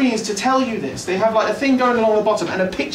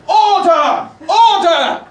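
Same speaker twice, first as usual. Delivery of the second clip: loud high mock bellow of order; Speaker shouting order; 9 semitones higher, 7 dB louder, much slower than usual. Speaker hollering at rowdy MPs, loud mock bellow. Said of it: "Mister Speaker just go".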